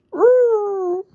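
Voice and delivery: very high whistled call; high bird call; loud bird whistle. Acted. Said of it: "she whistled loudly".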